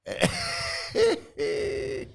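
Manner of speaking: high, drawn-out giggle; real high-pitched laughter; amused giggle. Real, not acted. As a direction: crack up helplessly. Laughing about talk of rice.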